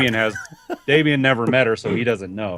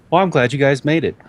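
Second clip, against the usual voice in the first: higher, sweetly welcoming NPC woman; [name]'s voice; 9 semitones higher, 5 dB louder than usual. Acted. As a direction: play the mysterious woman, all friendly. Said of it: "She's just going".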